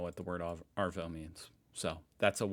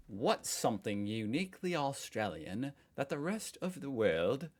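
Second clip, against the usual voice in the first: hammed-up British accent, pitched higher; bad British accent; 4 semitones higher than usual. Host reading an Ask an Australian title, bad British accent. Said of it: "bad British accent".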